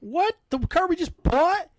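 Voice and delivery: high, loud "what?"; shocked disbelief. Retold or imitated. Hearing the new car broke down, he answers high and shocked. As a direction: sell the disbelief. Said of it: "And I'm like".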